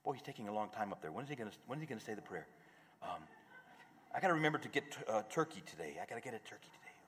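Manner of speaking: mild mock grumble, soft and quick; muttering to yourself in the pew; inner monologue. Bored parishioner thinking during the rite, soft and fast. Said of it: "distracted parishioner thoughts".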